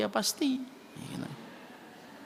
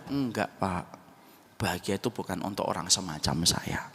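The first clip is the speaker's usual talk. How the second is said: sad reply; 6 semitones lower than usual. Retold or imitated. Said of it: "Sedih ngomongnya".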